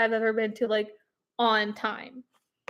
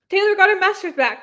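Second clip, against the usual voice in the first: excited; 7 semitones higher, 4 dB louder than usual. Real, not acted. Announcing the big news.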